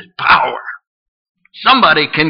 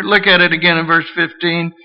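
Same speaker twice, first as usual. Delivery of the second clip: ordinary delivery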